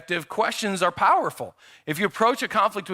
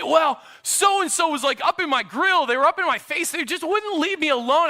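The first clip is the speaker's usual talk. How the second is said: put-on kid making excuses; 7 semitones higher than usual. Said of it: "And they might say".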